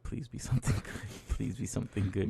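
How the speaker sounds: very soft, half-whispered pleading; hushed mock prayer for luck; muttered plea. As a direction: plead under your breath, as if to yourself. Retold silent plea before customer answers.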